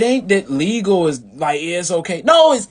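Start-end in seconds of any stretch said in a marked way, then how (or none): 2.2-2.7 insistent. Real, not acted. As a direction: insist "don't be legal" like a warning. Insisting on his sovereign claim.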